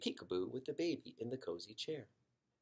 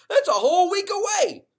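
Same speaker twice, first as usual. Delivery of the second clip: much higher, loud wail; boy protesting to his parents, much higher and louder; outraged kid; 17 dB louder than usual. Acted. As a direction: protest it at full pitch.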